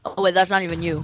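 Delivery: ordinary talk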